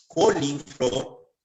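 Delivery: very slow co-li-flor; syllable by syllable. Shown as a demonstration. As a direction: break coliflor into long, separate syllables. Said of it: "al momento de escribir".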